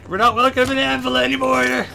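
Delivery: loud, high-pitched holler on the run; gleeful fleeing shout. Pretend. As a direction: shout it high, making a getaway. Shouting while fleeing the town guards.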